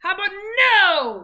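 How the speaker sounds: high loud drawn-out "no"; playful loud no. Shown as a demonstration. Mother modeling a loud "no" for her son, playful. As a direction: boom out "no" for him to copy.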